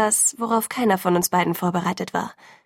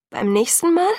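much higher, „Mal?“ rising in disbelief; incredulous echo; 10 semitones higher, 4 dB louder than usual. A narrator's reading. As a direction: throw „Beim nächsten Mal?“ back at him, high and incredulous. She echoes his words in disbelief. (ungläubig, hoch ansteigend auf „Mal“)

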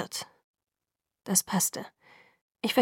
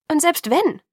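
higher, louder, sharp rise on wenn; defiant challenge; 8 semitones higher, 6 dB louder than usual. A narrator's reading. Bella defiantly challenging Edward, loud rising question. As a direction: fling the question back at him.

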